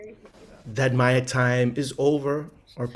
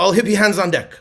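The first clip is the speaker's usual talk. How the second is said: call to crowd; 4 semitones higher, 7 dB louder, much faster than usual. Real, not acted. Calling crowd to action.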